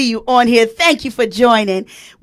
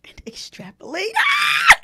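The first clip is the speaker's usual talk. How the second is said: squealing delight. Real, not acted. Host overcome by the word, whisper bursting into a squeal. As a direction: whisper rising into a very high squeal.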